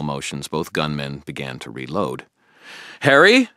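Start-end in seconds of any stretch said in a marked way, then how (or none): 3.0-3.5 very high, loud alarmed cry; alarmed yell; shout. A narrator's reading. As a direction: scream his name. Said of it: "Billy shouted".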